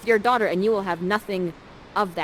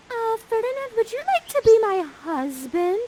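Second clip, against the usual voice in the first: put-on much higher, girlish proposal; shy Miranda; 13 semitones higher than usual. Miranda proposing to Ferdinand, high girlish question. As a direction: ask it sweetly like a smitten teen. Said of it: "Miranda says".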